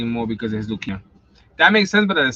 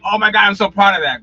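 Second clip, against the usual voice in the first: higher, louder mock gushing; mock pride; 6 semitones higher, 8 dB louder than usual. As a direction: gush like you're proud. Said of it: "I'm not gonna sit here and be like".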